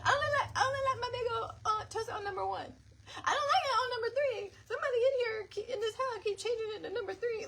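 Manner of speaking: put-on high, fast fussy child complaint; high-pitched son whining fast; whiny kid voice. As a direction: whine like a picky little boy. Son voiced complaining about toaster.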